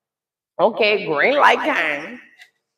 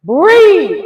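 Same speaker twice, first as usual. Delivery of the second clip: high loud urgent coaching; coaching breathe; 8 semitones higher, 9 dB louder, much slower than usual. Retold or imitated. Mother coaches daughter to breathe, high loud urgent. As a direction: coach her breathing at full volume. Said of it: "I said".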